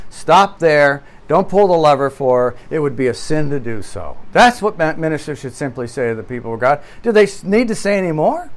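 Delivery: ordinary talk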